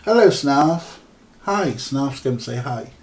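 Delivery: high-pitched pet talk; cat-greeting voice. Real, not acted. Streamer greeting a cat mid-stream, doting pet voice.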